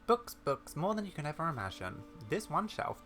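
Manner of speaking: high, eager enthusiasm; delighted, high-pitched showing off; excited book lover. Acted. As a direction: gush over a treasured collection. Character enthuses over shelves of books.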